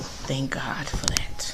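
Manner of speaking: whispered relief. Real, not acted. Aside relief about school.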